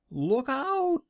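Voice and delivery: sudden high shrill-free scream of alarm; screamed warning. Acted. Mother screams at the wagon to look out, loud alarm. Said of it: "screamed a voice".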